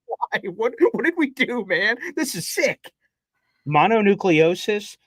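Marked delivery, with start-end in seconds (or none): 0.1-2.9 high hammed-up lament; mock despair to the sky. Jets fan crying to the sky at bad news, hammed-up high lament. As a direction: wail at the heavens in mock anguish. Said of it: "looked up to the sky and went".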